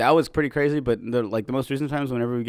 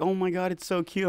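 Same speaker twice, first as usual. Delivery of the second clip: imitated gushing; 8 semitones higher, 4 dB quieter than usual. Stranger starting a chat over the cute dog, imitated gushing. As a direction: mimic someone cooing over a cute dog.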